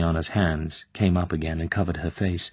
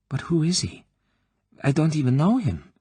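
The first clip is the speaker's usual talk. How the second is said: startled whisper; 9 semitones higher than usual. Acted. Tatiana bewildered by the colonel's order, startled. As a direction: blurt it out in shock.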